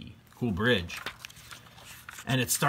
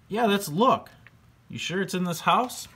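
put-on much higher, louder excited kid voice; eager boy George; 10 semitones higher, 3 dB louder than usual. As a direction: blurt it out, eager for treasure.

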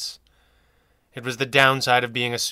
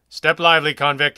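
sharp loud command to a convict; barked order; 3 semitones higher, 8 dB louder than usual. Acted. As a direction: bark it like a prison guard. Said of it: "he barked".

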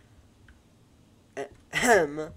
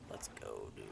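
whispered let's go; whispered cheer; 14 semitones lower, 10 dB quieter than usual. Real, not acted. Celebrating a small win to viewers, whispered. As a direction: breathe the victory under your breath.

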